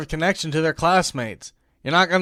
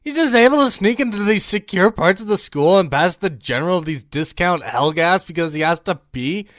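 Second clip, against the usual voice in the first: ordinary delivery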